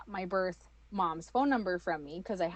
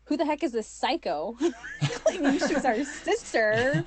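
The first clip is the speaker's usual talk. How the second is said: high, loud mock disbelief through laughter; incredulous siblings; 7 semitones higher, 6 dB louder than usual. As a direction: act out baffled siblings, laughing.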